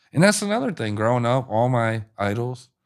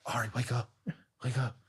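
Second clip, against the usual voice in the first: hushed wake-up call; 12 dB quieter than usual. Retold or imitated. Mom softly waking her son at the toll booth, hushed. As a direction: nudge a sleeping kid awake gently.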